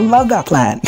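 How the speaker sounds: high-pitched, gushing approval; retold squeal of approval; mock delight. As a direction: gush it, imitating a delighted schemer. Retelling a character's delighted approval.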